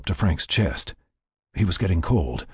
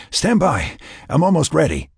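raised, high radio call; 8 semitones higher, 3 dB louder than usual. A narrator's reading. Teammate radios to hold on until ready, calling out. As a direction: call it over the comm set.

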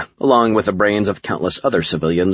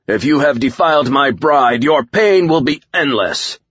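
high, loud threat, slowing at the end; menacing alien warlord; 8 semitones higher, 4 dB louder than usual. A narrator's reading. Alien warlord threatens whoever defiled his bride, menacing. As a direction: pronounce doom on your rival. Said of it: "Akuma was serious".

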